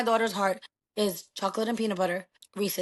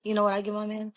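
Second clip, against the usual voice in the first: ordinary delivery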